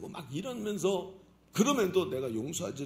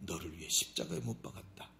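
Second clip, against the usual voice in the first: hushed, breathy whisper; whispered voice of God; 10 semitones lower, 7 dB quieter than usual. Acted. God whispers his sacrifice to the sinner. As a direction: breathe the words like a secret.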